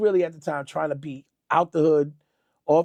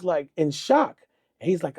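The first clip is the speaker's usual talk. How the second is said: usual speaking voice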